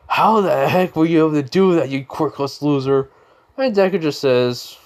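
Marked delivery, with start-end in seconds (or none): 0.1-3.1 louder, higher-pitched acted outrage; indignant outburst. Beaten rival demanding how he did it, indignant. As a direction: fire the insult at your rival.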